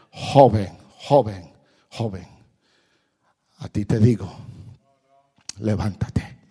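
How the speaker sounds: low urgent call; preaching call. Acted. Preacher calls the youth to rise, urgent sermon call. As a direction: summon them.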